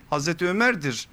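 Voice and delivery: ordinary talk, nothing marked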